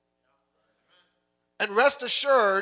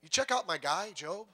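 soft, hushed, casual boasting; God bragging softly; 9 dB quieter than usual. Acted. God quietly bragging to Satan about Job, soft and casual. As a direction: murmur it like a proud friend sharing a secret. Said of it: "And God goes".

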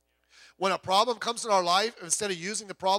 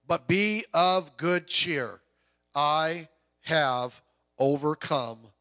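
slow, weighty scripture reading; scripture read slowly; 6 dB louder, much slower than usual. Acted. Pastor to congregation, reading Jesus' words slowly and solemnly. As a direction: let each phrase land with solemn weight. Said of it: "reading Jesus' words".

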